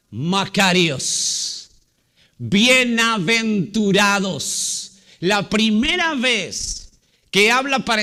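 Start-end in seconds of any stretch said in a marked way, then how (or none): 0.1-4.9 slow, deliberate stress on Macarios; slow emphasis. Shown as a demonstration. Preacher stressing a key Greek word, slowly. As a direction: draw out Bienaventurados word by word. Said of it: "enfatiza la palabra".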